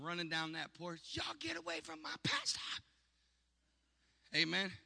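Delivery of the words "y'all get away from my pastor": put-on high shrieking old church mother; Mama Brown screaming. Old church mother screaming at big dudes to protect her pastor. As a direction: screech it like a fierce old lady chasing men off. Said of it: "She started screaming".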